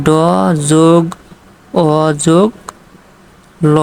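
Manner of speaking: "ড... যোগ... অ... যোগ... ল" with a long pause after each piece; sounded out. Shown as a demonstration. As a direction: break ডল into ড, অ, ল, each sound standing alone. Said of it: "এই ড উচ্চারণ করতে গেলে".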